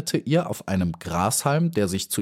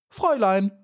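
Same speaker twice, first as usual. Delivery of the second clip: calling out; 11 semitones higher than usual. Acted. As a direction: hail her from the grass stalk. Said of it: "rief er".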